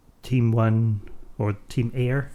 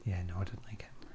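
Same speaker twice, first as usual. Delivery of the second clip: hissing weasel whisper; 4 semitones lower, 12 dB quieter than usual. Retold or imitated. Whispered Weasel follow-up line.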